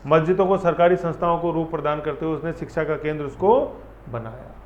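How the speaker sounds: normal talking voice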